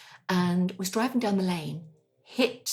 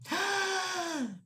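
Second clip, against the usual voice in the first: gasp; 13 semitones higher, 5 dB quieter than usual. Retold or imitated. Driven without oil.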